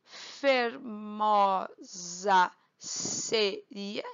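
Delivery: slow, separated syllables for counting. Shown as a demonstration. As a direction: break the verse into drawn-out syllables. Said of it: "então, um, dois".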